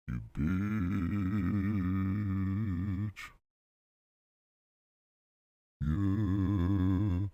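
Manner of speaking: long wobbling processed voice; voice effect. Pretend. Comedian playing with effects, drawn-out wobbly voice. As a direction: stretch each word into a warble. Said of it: "use a different effect for it".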